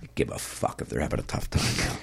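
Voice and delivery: low, hushed sarcastic aside; whispered aside. Real, not acted. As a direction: mutter it under your breath.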